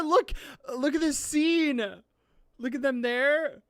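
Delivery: high, insistent, emotional gushing; excited urging. Real, not acted. Urging viewers to see beloved scene.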